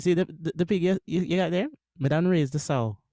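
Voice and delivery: the proverb in a Jamaican accent. Shown as a demonstration. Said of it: "Can you say it with a Jamaican accent again?".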